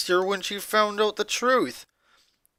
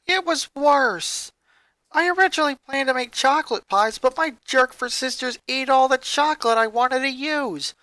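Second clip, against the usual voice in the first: put-on higher, bitter boy's voice; Lincoln's voice; 7 semitones higher than usual. Lincoln venting to Ronnie Ann about his sisters, higher. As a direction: voice a resentful young boy venting.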